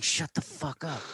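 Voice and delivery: high put-on cop voice; cop snapping. Cop snapping at the kid to shut up, high voice. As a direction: snap like a fed-up cop.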